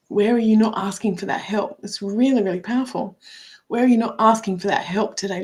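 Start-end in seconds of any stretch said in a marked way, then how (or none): none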